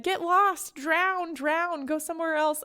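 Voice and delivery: high, mock-fierce get lost. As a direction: shoo him off from a distance, voice up. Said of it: "he said".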